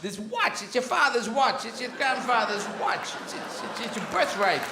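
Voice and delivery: a hint of Walken's broken rhythm; halting Walken cadence, lightly put on; lightly voiced Christopher Walken monologue; bad Walken impression. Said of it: "This is Christopher Walken".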